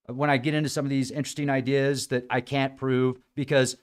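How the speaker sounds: ordinary talk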